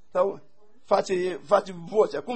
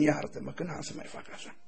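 softer, lower, fading to a half-voiceless plea; a husband asking forgiveness, close to tears; tearful apology; 8 semitones lower, 10 dB quieter than usual. Retold or imitated. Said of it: "El se întoarce și plânge".

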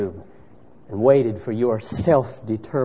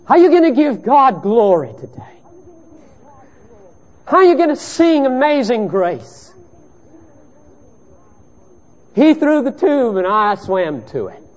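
high, loud, driving pulpit voice; impassioned sermon delivery; fervent preaching; 13 semitones higher, 8 dB louder than usual. Acted. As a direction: preach it out to the whole room. Preaching at full force.

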